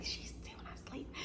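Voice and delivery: unvoiced hushed whisper. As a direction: whisper it so the patient won't hear. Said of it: "she whispers".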